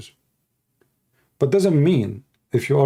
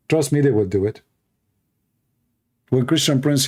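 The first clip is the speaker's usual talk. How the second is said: ordinary talk, nothing marked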